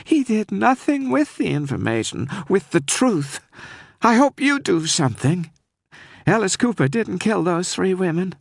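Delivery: holding back tears as she pleads; tearful Southern woman. Acted. Frightened caller begging the detective to save Cooper, tearful. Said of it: "I was sure she was sobbing".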